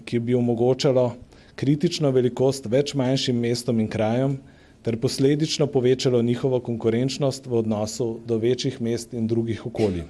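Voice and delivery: ordinary delivery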